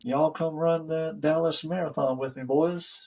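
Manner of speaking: put-on Texas accent. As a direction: invite folks along in a Texas accent. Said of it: "She's got the Southern, uh, Texas accent".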